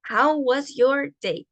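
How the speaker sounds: slow, careful English model; slow model phrase. Shown as a demonstration. Teacher presenting a new phrase slowly. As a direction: lay out each word slowly.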